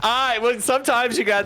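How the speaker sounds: giggling excuse; laughing. Real, not acted. Laughs after being questioned.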